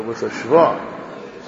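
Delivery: "shva" drawn out slowly; wrong vowel. Shown as a demonstration. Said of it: "many people say it with a".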